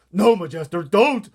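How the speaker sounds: loud, high urgent cry; alarmed cry. A narrator's reading. BFG warning the Queen off, alarmed shout. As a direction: stop her before she bites. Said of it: "cried the B F G".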